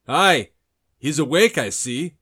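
loud, high taunting call; captor calling out. Acted. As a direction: crow it out to the other captor. Captor calls out on waking.

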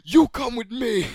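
high, loud, slow furious command; stern order. Retold or imitated. Counselor to the annoying kid, loud stern order. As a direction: lay down the law, each word heavy.

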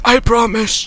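lightly acted wounded man's assurance; mock strained promise. Departing doctor reassures Adam, mock strained promise. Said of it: "And he's like".

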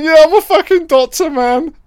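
high, loud mock Tom Cruise voice; hammed-up high Cruise bragging; Tom Cruise impression. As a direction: ham up a cocky high-pitched Tom. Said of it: "Tom's like".

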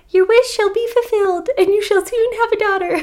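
high, squeaky fish voice; little fish voice. Acted. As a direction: play the magic fish brightly. Fish speaks in story.

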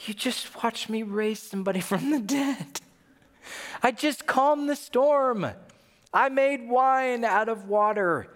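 high, drawn-out exasperated plea; frustrated but not mean. Acted. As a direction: list the miracles in pained disbelief. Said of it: "he got a little frustrated".

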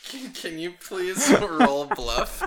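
high, slow, laughter-filled request; laughing. Real, not acted. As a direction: struggle to ask through real laughter. Game master cracking up at player.

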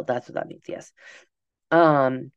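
ordinary talk

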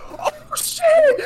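high, loud "Oh shit!" with a cry in it; a real burst of surprise at the win; shocked shout. Retold or imitated. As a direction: yelp it out in disbelief. Retold shock at a winning spin.

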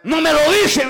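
usual speaking voice

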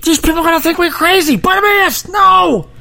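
high, exasperated hushing of a nuisance; annoyed crowd. Retold or imitated. Crowd hushing the blind beggar, high and exasperated. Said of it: "And the crowd's like".

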